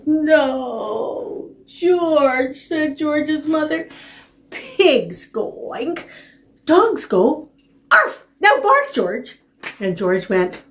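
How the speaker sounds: exaggerated storytime teaching; reading to a toddler. Mother voiced to puppy.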